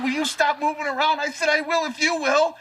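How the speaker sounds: high, strained comic pleading; mock squealed protest. Acts out protest to the doctor.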